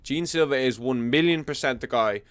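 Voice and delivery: ordinary talk, nothing marked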